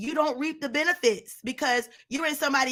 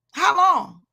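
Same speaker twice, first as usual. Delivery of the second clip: pressing the question louder; insistent call; 3 semitones higher, 5 dB louder than usual. Retold or imitated. Preacher voicing God's question to Saul, loud insistent call. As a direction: push the rebuke home. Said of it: "that asked Saul".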